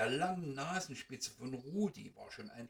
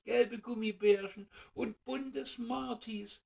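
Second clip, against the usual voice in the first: viel höher und lauter, begeistert; aufgeregtes Staunen; 9 semitones higher, 6 dB louder than usual. Acted. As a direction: wie Kinder vor dem Gabentisch. Mice marvel at the sweets. (Mäuschen bestaunen die Süßigkeiten, hoch und begeistert)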